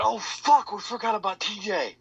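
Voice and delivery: acted-out cry of alarm; panicked realization. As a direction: blurt it out high, imitating sudden panic. Panicked quote after hearing a scream.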